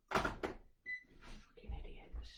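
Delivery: whispered curse, barely voiced; swearing under breath. Real, not acted. Annoyed at a cold caller, whispering a curse. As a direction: hiss the insult so the caller can't hear. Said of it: "I didn't swear then".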